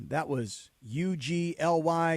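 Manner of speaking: slow teasing spell-out; spelled out slowly. Acted. Spelling out for emphasis.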